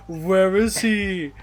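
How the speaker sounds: slow, drawn-out loud call; mock dramatic demand. [name] searches for his dog, drawn-out mock demand. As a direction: act out a hammed-up search cry.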